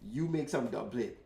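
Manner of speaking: ordinary talk, nothing marked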